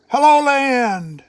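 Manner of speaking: high, loud, slow welcome; joyful greeting. A narrator's reading. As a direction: call the land out with delight. God greets creation joyfully.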